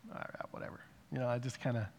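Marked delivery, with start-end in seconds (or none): 0.1-0.8 very soft, near-voiceless brush-off; quiet, reluctant whatever; dismissive mumble. Retold or imitated. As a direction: shrug it off under your breath. Grudging brush-off of being called out.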